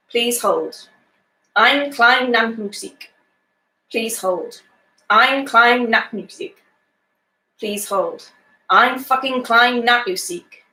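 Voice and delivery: measured, deliberate poetry reading with comic timing; poem reading. Acted. Teacher reading a funny poem to her class, dry and deliberate. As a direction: read the poem aloud to the class, savouring the satire.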